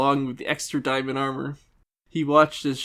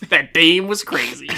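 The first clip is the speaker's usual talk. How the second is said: louder hardboiled detective voice; noir detective; 3 semitones higher, 6 dB louder than usual. Acted. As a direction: play it like a noir gumshoe. Noir take with dame.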